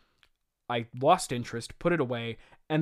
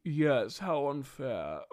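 slow, drawn-out mock sympathy; dripping sarcasm, stretching the last word; sarcastic; much slower than usual. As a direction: pretend to pity him, very slowly. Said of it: "Doc Ock says sarcastically".

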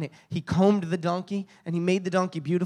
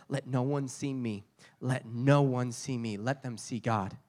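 quiet prayer; 5 semitones lower, 5 dB quieter than usual. Retold or imitated. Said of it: "I'm praying the whole time".

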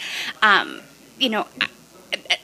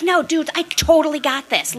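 higher, louder self-assured boast; cocky confidence; 8 semitones higher, 4 dB louder than usual. Retold or imitated. Guest voicing her confident self, cocky. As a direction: swagger through it.

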